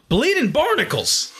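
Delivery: high, stretched comic oath; exclaimed with pitch way up, drawn out; pirate exclamation. Acted. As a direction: cry it out as a mock-startled pirate. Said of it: "lot of really good exclamations".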